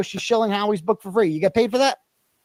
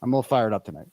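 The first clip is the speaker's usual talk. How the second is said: ordinary talk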